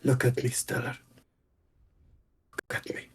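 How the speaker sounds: quiet, hushed plea; soft in-character plea. Acted. Elf to brother whose hand shakes, soft plea.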